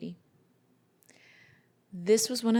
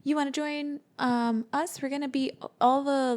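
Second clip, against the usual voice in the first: bright, much higher excited kid voice, imitating; mock eager kid pitched way up; super excited kid; 6 semitones higher than usual. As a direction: bounce in like an eager boy asking to team up. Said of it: "like, super excited".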